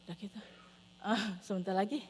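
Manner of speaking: ordinary talk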